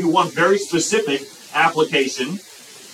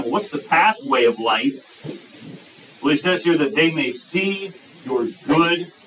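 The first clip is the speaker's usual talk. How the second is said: usual speaking voice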